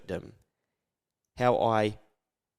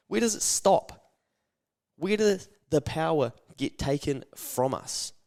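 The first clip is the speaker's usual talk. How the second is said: ordinary talk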